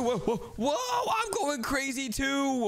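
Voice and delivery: high, slow giddy whoops with a laugh; laughing wildly at sudden super speed; hyper excited. Pretend. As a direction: bounce off the walls from the energy drink. Energy drink kicks in, hyper.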